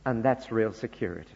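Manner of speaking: normal talking voice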